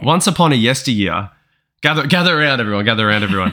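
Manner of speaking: storyteller gathering the crowd; grand once-upon-a-time storyteller; hushed creepy legend telling. Acted. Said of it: "my amazing analogy".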